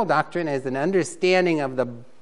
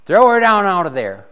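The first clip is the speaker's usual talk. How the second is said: raised command, higher and louder; commanding call; 4 semitones higher, 7 dB louder than usual. Acted. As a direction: bark the order up at the window. King orders Jezebel thrown down.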